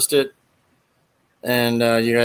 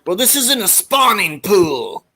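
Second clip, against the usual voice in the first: a hint of a high, slow smug officer; drawn-out smug put-down; Harris character voice; 7 semitones higher than usual. Retelling Harris's pool joke, lightly voiced slow smug Harris. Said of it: "Harris said".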